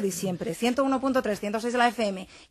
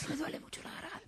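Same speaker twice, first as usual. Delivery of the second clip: voz bajita; 7 semitones higher, 15 dB quieter than usual. Shown as a demonstration. Imitates whispered sore-throat talk.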